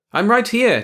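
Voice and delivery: high, loud call; crying out. A narrator's reading. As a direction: pipe up to be noticed. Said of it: "George cried".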